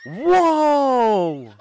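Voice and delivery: squealing laugh, real; helpless high laughter; high burst of laughter. Real, not acted. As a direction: break into a high cackle. Cracking up at the trick.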